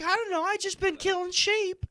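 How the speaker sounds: high, airy imitation of the wife; mock wife voice. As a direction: shrug it off in a put-on high voice.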